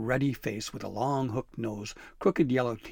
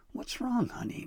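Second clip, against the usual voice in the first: much higher, gentle granny query; high grandma voice; 12 semitones higher than usual. Acted. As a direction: ask like a caring nana. Grandma asks worried grandson.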